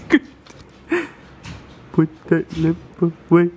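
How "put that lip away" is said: evenly spaced words at a middle pitch; playful chant-like order, word by word; teasing baby command. Pretend. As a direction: pop out each word separately. Second take, slightly higher.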